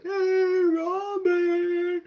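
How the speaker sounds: loud, high, drawn-out holler; long, high shouted hello; imitated calling out. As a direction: holler down a hallway in mock greeting. [name] calls running down the hallway.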